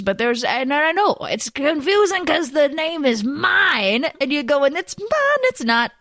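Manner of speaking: character voice of a cheerful miner; friendly Jeremy Bucky. Acted. Jeremy Bucky greets the hermit.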